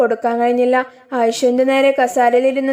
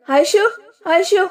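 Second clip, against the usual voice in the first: alarmed calling; 6 semitones higher, slower than usual. A narrator's reading. Shahi sees her collapse.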